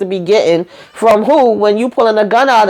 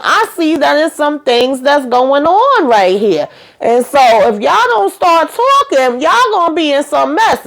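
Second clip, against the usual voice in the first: retold threatening warning; 7 semitones higher than usual. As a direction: stretch it out high, putting them on notice. Acted warning to two suspects.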